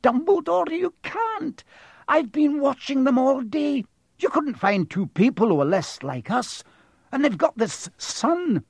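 very high, agitated protest; appalled cry. A narrator's reading. McGonagall objecting to Harry living with them, appalled. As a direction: protest loudly in horrified disbelief. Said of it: "cried Professor McGonagall".

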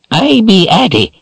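high raspy crone voice; raspy old woman. A narrator's reading. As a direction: rasp it out like an old witch. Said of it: "she whispered in a raspy voice".

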